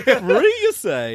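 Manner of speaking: high-pitched mock wonder, then dropping; feigned surprise. Customer acting amazed to the barista, hammed-up surprise. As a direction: marvel theatrically as if it's news. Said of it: "I've gotta act as".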